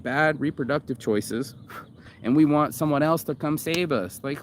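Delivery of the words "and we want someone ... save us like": ordinary delivery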